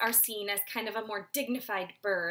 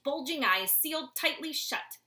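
ordinary delivery

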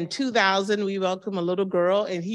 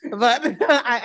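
a real laugh breaks into the words; 4 semitones higher, 6 dB louder than usual. Real, not acted. Telling the pastor she must go to college, laughing. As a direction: laugh through the word, then go on. Laughs while quoting herself.

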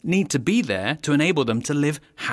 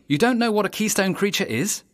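mock surprise; 7 semitones higher than usual. Narrator to an unseen child who asks, mock surprised. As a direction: pretend surprise, voice jumping higher on don't. Narrator answers imagined listener.

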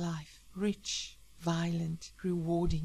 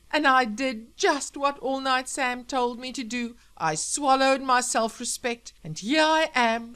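loud, high-pitched gushing reunion; motherly wife Rose, emotional; 7 semitones higher, 8 dB louder than usual. Acted. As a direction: declare devotion to your husband breathlessly happy.

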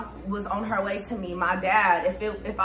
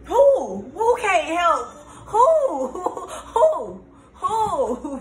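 high, loud hoots breaking into laughter; whooping; 10 semitones higher, 6 dB louder, much slower than usual. Real, not acted. Reacting to grandpa's blunt words, whooping. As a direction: whoop like that lesson really landed.

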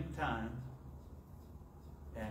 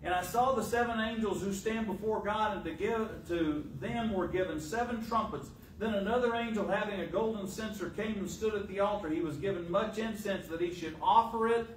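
raised, higher preacher's reading voice; scripture reading; 7 semitones higher, 3 dB louder than usual. Real, not acted. Preacher reads the angels' vision to the congregation, scripture reading. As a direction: read scripture out to a congregation, pitched up.

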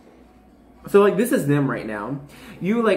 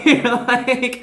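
laughing; 10 semitones higher, 5 dB louder than usual. Real, not acted. Laughing while voicing viewer.

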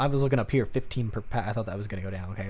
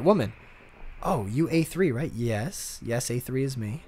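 normal talking voice

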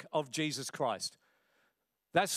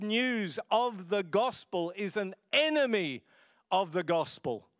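emphatic and heartfelt, driving each point home; passionate preaching; 3 semitones higher than usual. Real, not acted. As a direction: preach it with conviction, stretching the big words. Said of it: "I feel rather passionately about this".

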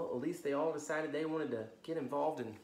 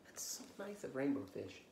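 soft whispered aside; 7 dB quieter than usual. Real, not acted. Storyteller whispers praise to listening kids.

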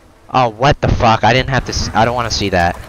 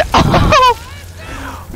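high shrieking laugh. Real, not acted. Laugh after being comboed.

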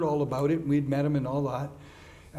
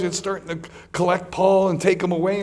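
ordinary delivery

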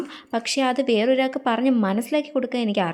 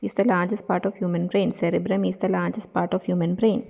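rote memorising; 3 semitones lower, much slower than usual. Acted. Studying, memorising aloud slowly.

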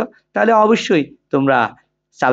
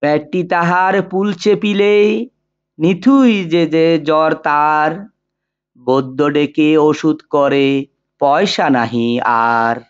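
poem recitation. Shown as a demonstration. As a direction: recite it line by line, sustained and rhythmic, with long pauses between couplets. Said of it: "এখন কবিতাটি আবৃত্তি করছি".

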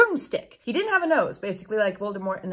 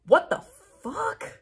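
1 stunned disbelief; 9 semitones higher, slower than usual. Real, not acted. Reader stunned by the text.

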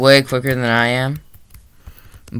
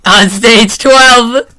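taunting; 10 semitones higher, 8 dB louder, much slower than usual. Acted. Taunting friend.

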